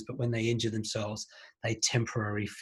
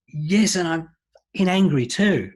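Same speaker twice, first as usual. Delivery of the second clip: louder and higher, anger breaking through; retold angry outburst, higher and louder; rising anger; 7 semitones higher, 8 dB louder, slower than usual. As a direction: let the anger spill out over the sadness. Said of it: "you can hear the anger rising in her voice".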